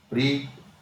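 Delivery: प्रीपोजिशन broken into pre-po-zi-tion, pauses between; syllable by syllable. Shown as a demonstration. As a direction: sound out प्रीपोजिशन in four separate syllables. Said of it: "ऐसे बोलेंगे हम इसको".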